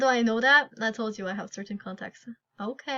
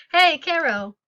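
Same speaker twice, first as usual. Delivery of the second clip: loud, higher call to get her attention; 5 semitones higher, 8 dB louder, slower than usual. Acted. Player calling loudly after Caro again. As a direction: shout to make her turn round.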